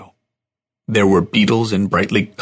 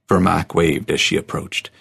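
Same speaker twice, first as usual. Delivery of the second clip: ordinary delivery, nothing marked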